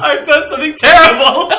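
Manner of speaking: loud, high mock-horrified cry through laughter; high hammed-up exclamation; mock horror. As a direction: wail it in mock despair, laughing. Mock horror at the bomb plan.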